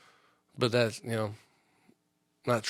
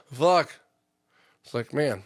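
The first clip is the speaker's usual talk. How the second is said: ordinary talk, nothing marked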